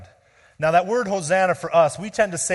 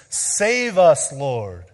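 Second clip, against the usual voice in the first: a raised crowd's cry; 7 dB louder, much slower than usual. Retold or imitated. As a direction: call "Save us" out urgently. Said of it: "crying out to Jesus".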